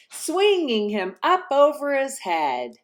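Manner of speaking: high, bouncy storytelling; excited narration. A narrator's reading. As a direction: tell the tumble with delight.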